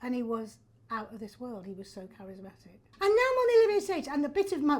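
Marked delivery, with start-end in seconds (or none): 3.0-4.8 high, quick burst of delight; excited. Real, not acted. Actress now plays the stage named after Olivier, excited. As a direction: exclaim it high and fast. Marvels at coming full circle.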